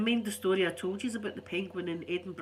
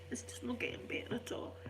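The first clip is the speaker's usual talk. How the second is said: whisper; 11 dB quieter than usual. Real, not acted. Despairing about illness.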